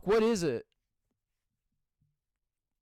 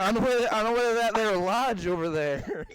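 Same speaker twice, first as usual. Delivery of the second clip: put-on southern accent. Joking about heading to the lodge, southern accent. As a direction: put on a folksy southern twang. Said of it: "went southern".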